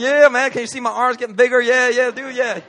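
loud and pumped, chatting up the trainers; loud gym bro greeting; loud buddy cheer. Retold or imitated. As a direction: bro it up, all hype. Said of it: "I'm just like".

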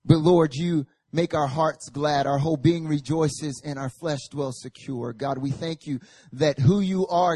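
ordinary talk, nothing marked

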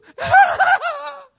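loud, high wailing sob; mock weeping wail. Imitating Jesus at Lazarus's tomb, loud mock weeping. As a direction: burst into exaggerated weeping. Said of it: "he started weeping".

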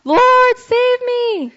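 loud, high desperate cry for help. Acted. Preacher voices sinking Peter calling to Jesus, crying out. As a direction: call out high and loud, drawing out the plea. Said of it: "he cried out".

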